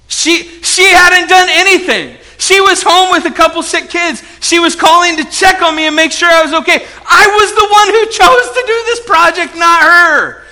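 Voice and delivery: high, loud confession; worked-up preaching outcry; impassioned self-reproach. Real, not acted. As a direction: build to an exasperated shout at yourself. Owning up to misplaced anger at wife.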